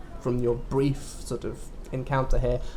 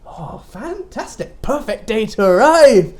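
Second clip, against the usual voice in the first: put-on high, sly old shopkeeper voice; sweet but sinister seller; sly mysterious shopkeeper; 7 semitones higher, 3 dB louder than usual. Toy seller.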